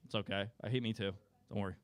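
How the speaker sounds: usual speaking voice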